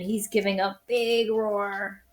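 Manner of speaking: ordinary delivery, nothing marked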